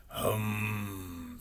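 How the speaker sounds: soft, drawn-out humming snore; snoring. A narrator's reading. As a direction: hum a long sleepy snore. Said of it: "snored Robot".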